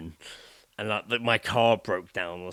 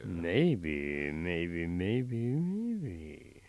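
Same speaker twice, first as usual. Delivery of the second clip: teasing maybe; 5 semitones lower, 5 dB quieter, much slower than usual. Acted. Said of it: "teasing exclusives".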